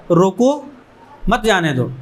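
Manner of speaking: रोको, then a clear break before मत जाने दो; pause after रोको. Shown as a demonstration. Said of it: "अगर कॉमा लगा होगा".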